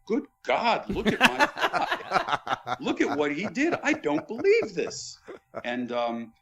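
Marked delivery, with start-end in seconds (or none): 0.1-2.8 put-on high, slow, stunned British accent with a laugh; a British accent, pitched high and aghast; Lennox's British accent. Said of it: "in his British accent". 2.8-5.2 put-on a British accent, pitched high and aghast; Lennox's British accent. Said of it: "in his British accent".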